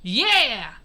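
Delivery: loud drawn "yeah"; crowd cheer. A narrator's reading. Everyone rallying round Henry Freeman, loud cheer. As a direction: whoop it like a whole crowd. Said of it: "everyone around Henry Freeman said".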